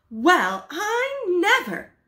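high, slow amazement; impressed. Acted. Wife impressed by husband's letter, amazed. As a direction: marvel at it. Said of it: "very much impressed".

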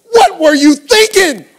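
high, full-voiced dad yell; angry yelling. Dad scolding daughter over thrown snow, angry yelling. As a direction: yell it in furious disbelief. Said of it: "I'm, like, yelling at her".

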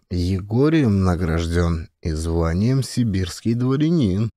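plain reading voice

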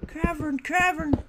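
cavern sung out very high, pleading; singsong high plea. Acted. Gamer pleading at the screen, high singsong. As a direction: chant it high like a wish.

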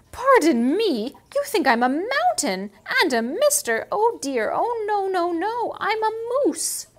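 loud, flustered moose. Acted. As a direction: fuss at being called a mountain. Moose replies to snake.

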